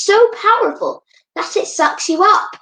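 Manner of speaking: high dramatic rise. A narrator's reading. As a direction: make it sound dramatic. Dramatic monster vacuum image.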